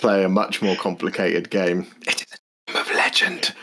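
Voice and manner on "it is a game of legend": whisper. Shown as a demonstration. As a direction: breathe it like a bedtime secret. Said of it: "I'm just whispering her".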